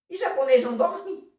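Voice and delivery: put-on high, loud questioning child; Armandinho. Son challenging his father about Japan, high loud questioning voice. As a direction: pipe up loudly with a boy's objection. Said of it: "o pobrezinho do Armandinho".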